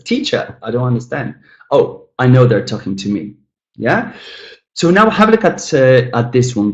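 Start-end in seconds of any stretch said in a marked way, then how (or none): 0.0-0.6 "Teacher" high on TEA, falling away; stress on first syllable. Shown as a demonstration. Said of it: "but someone says".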